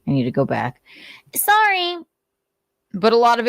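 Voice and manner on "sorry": squeaky sorry. Real, not acted. Sudden squeaky apology.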